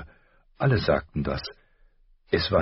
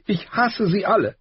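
put-on much higher and louder, bitter girl; tearful hate; 4 dB louder than usual. A narrator's reading. Arya repeating her hatred to her father, higher and louder. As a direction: hurl it out through tears.

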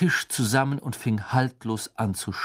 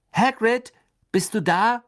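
high, loud call through a door; 12 semitones higher, 6 dB louder than usual. A narrator's reading. Harry calls to Hagrid at his door, calling out. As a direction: call out to see who's home. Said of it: "rief Harry".

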